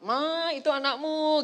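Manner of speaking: slow, firm call to his wife; husband calling wife. Retold or imitated. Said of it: "Pak Eri berkata".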